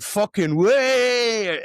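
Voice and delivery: long, high, loud whoop; unfiltered excitement. Retold or imitated. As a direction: let loose a drawn-out "wee". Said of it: "the filter drops".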